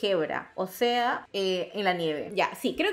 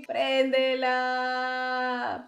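whiny plea; much slower than usual. Real, not acted.

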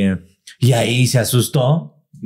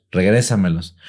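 ordinary talk

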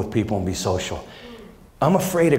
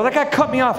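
high, outraged complaint; a dad griping to his son about the driver; indignant dad; 12 semitones higher than usual. Retold or imitated. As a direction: jab at the driver who cut him off. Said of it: "I go".

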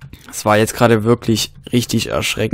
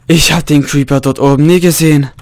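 shaken exclamation; 4 semitones higher, 7 dB louder than usual. Real, not acted. Shaken right after the creeper scare.

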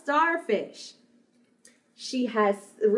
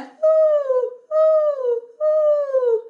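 loud, very high owl-like "ooh"; high owl hoot; 13 semitones higher, 6 dB louder, much slower than usual. Shown as a demonstration. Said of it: "High voice sounds like".

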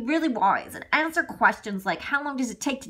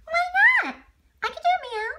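put-on high, whiny kid frog; little frog child; 3 dB louder, much faster than usual. Son frog whines to Daddy in a high child voice. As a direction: pipe up like a small frog child.

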